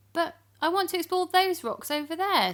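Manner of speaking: put-on high whining little boy voice; small egg-cup boy pleading; pleading child. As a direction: beg like a cheeky child. Joe pleads with Grandpa.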